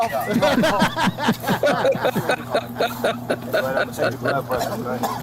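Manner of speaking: long, loud belly laugh; real laughter. Real, not acted. Host cracking up at a co-host's wrong lyric, real laughter. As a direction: crack up helplessly.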